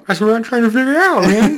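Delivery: high, loud alien grumbling; whiny high alien voice. Pretend. Alien voice in a skit.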